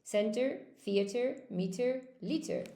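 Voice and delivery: "center, theater, meter, liter" ending in -ter; correct pronunciation. Shown as a demonstration. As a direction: read out the model list "center, theater, meter, liter" with final -er. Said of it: "it should be center".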